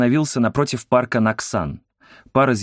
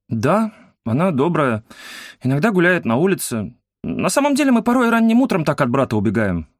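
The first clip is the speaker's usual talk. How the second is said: put-on shy boy's voice; 6 semitones higher than usual. A narrator's reading. Boy tells the woman about running from his brother, in a high confiding voice.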